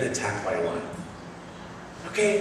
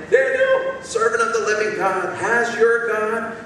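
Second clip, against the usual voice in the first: lamenting cry; 7 semitones higher, 3 dB louder than usual. Acted. King calling into the lions' den, lamenting. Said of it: "with a lamenting voice".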